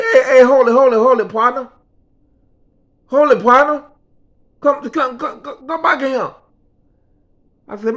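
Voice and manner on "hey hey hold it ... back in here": loud, high calls of hold it, partner; calling out to stop him. Retold or imitated. Boss shouting after the worker leaving his office, loud and high. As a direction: shout after him to come back, pitched up.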